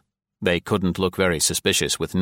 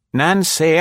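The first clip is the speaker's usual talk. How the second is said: higher, louder composed matron calling a name; calm older lady; 10 semitones higher, 6 dB louder than usual. A narrator's reading. Nora calling Nancy by the body, calm and higher. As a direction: call her name with calm authority. Nora's voice, high.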